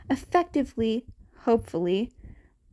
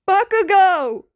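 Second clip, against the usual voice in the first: loud, much higher scream of a name; a friend screaming to the blond mid-rescue, loud and high; 8 semitones higher, 9 dB louder than usual. Acted. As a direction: scream across the gap to him. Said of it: "Kirishima screamed".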